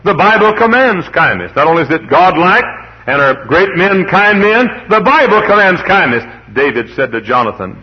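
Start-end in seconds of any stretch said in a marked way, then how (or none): 0.0-6.2 high, loud pulpit proclamation; fervent sermon declaration; full-force preaching. Acted. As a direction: thunder it from the pulpit.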